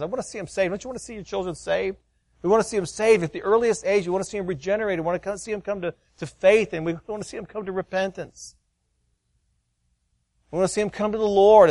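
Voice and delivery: put-on high, rising sermon to his children; fervent preaching. Father preaching to his little kids in family worship, fervent. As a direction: preach the gospel hard, pleading. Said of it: "I'm really preaching on this hard one day".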